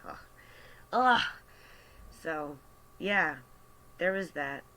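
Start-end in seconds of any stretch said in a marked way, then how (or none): 0.9-1.2 loud, higher-pitched "ugh"; exasperated groan. Real, not acted. Cashier recalling an entitled customer, exasperated groan.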